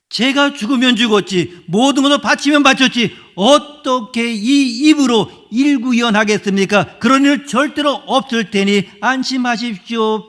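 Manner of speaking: loud, high and confident, swearing loyalty; forceful vow. Acted. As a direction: Peter promising boldly, almost shouting. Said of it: "베드로는 힘있게 예수님에게 이야기했습니다". (힘차고 자신만만하게 외치듯)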